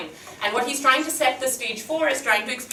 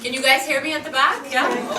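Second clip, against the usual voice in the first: raised voice, higher and louder, calling to the back of the room; projecting to the back; 6 semitones higher, 4 dB louder than usual. Real, not acted. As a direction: project so the back row hears you. Speaker without mic checks back row.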